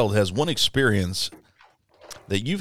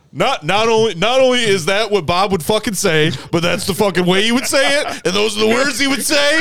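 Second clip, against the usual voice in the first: much higher and louder, laughing through the words; Todd, laughing hard; 13 semitones higher, 7 dB louder than usual. Retold or imitated. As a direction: gasp it out through a belly laugh. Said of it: "He's like".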